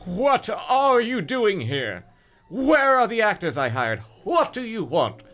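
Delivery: hammed-up frantic plea; pleading. A narrator's reading. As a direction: beg for answers, rising panic. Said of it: "Brendan pleaded".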